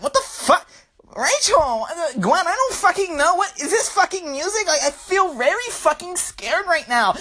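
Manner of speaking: put-on high, panicky girl voice; mock-terrified high voice; scared girlfriend. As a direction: squeal it like a frightened clubgoer. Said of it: "Rachel and Gwen are just like".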